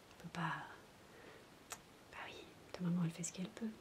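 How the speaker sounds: ordinary delivery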